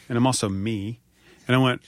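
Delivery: ordinary delivery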